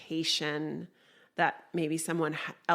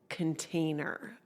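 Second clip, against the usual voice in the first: ordinary talk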